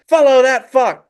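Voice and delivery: high, loud command to the driver; shouted order. Acted. Po ordering the rickshaw after Zhen, high shout. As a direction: yell it out dramatically.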